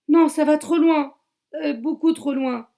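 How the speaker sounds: high, loud protest; deputy refusing the killer, loud and high; panicked shout. Acted. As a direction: yell it out in horror.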